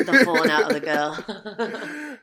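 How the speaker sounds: slow, laughing punchline. Real, not acted. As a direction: drag it out while laughing.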